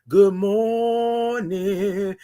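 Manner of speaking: very slow, drawn-out good morning; stretched greeting. Shown as a demonstration. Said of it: "You gotta say it like that".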